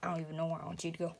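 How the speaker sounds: fast quiet aside. Real, not acted. Quick mumbled aside.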